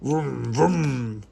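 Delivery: drawn-out engine vroom; humming engine noise, swelling; motorbike imitation. A narrator's reading. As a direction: rev it like a motorbike. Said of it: "He rides his motorbike".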